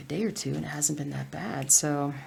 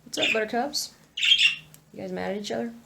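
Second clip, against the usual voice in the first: cutesy pet talk; 6 semitones higher, faster than usual. Acted. Talking to the birds.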